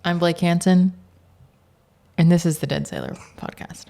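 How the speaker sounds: loud, punchy news-podcast host intro; radio voice. Shown as a demonstration. Guest tries his own show intro in a daily-news podcast voice. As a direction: announce each phrase firmly, with pauses between. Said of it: "Let me try mine now".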